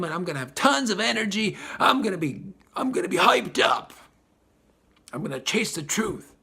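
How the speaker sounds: high-pitched and fast, rising into a shout at hyped up; mock pep of a soul before birth, hammed-up; eager excited quote. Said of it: "you're like".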